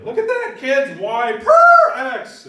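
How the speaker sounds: high, loud excited shout; excited yell. Retold or imitated. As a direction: yell with delight across the library. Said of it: "I yelled that out at the library".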